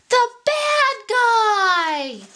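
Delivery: high, slow, drawn-out cry; alarmed recognition. Acted. Pete spots the bad guy, a slow high alarmed cry. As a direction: dread as he spots him.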